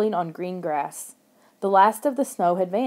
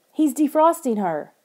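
higher-pitched, happy announcement; happy cry; 6 semitones higher, 3 dB louder than usual. A narrator's reading. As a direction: call out the good news happily. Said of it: "I cried happily".

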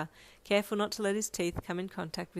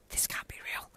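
whispered disbelief; hushed, soft whisper to yourself; whispered thought; 7 dB quieter than usual. A narrator's reading. Listener's inner thought, whispered.

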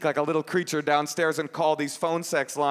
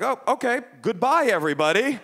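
cheery farewell; 6 semitones higher than usual. Retold or imitated. Teenage boy taking leave of everybody, cheery mock farewell. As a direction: call it out as you slip away. Acts out teenage self leaving.

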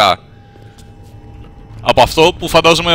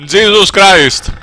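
slow, higher, drawn-out exclamation; long-drawn raised cry; exasperated oath; 6 semitones higher, much slower than usual. Real, not acted. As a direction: stretch each word out in disbelief. Repeats the oath, escalated.

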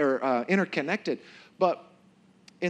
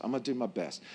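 quiet aside; 3 semitones lower, 7 dB quieter, faster than usual. Shown as a demonstration. Repeat dropped to a low aside.

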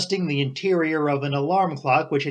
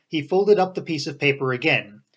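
plain reading voice

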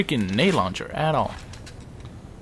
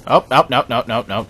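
quick loud bursts of alarm; alarmed gamer yelp; panicked refusal; 8 dB louder than usual. Real, not acted. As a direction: blurt it out in startled, repeated bursts. Panicking at an enemy in game.

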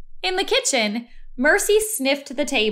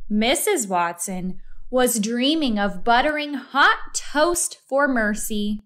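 ordinary delivery

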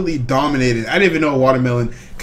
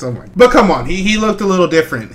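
normal talking voice

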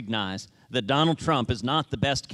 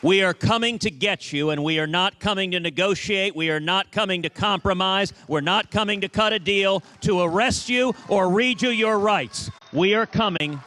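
rousing, defiant rally cry; rally speech; 3 semitones higher than usual. Real, not acted. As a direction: build it up for a cheering crowd. Rousing rally crowd, threatening enemies.

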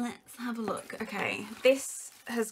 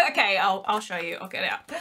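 ordinary talk

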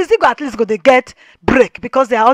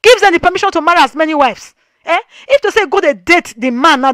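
normal talking voice

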